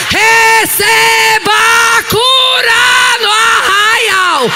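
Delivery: full-force revival preaching; fiery preaching. Acted. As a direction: preach it out to the crowd.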